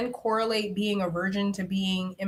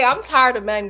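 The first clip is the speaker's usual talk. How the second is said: ordinary talk, nothing marked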